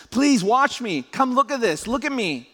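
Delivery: high, insistent calls to parents; pleading kid. Acted. Kids to their parents, high insistent pleading. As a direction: call out like a kid wanting attention. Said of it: "kids always say to their parents".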